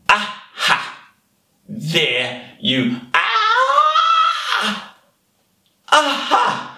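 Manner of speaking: high, gleeful "aha" pounce; triumphant discovery. Acted. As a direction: cry out as you catch sight of prey. Anteater spots and goes for the ant.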